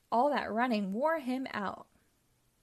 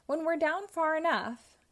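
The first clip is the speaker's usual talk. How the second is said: higher, louder villain's glee, no real cackle; lightly cackled scheming; cackling; 7 semitones higher, 5 dB louder than usual. A narrator's reading. Pinky plots the tunnel with the other ghosts, mildly cackling. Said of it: "cackled Pinky".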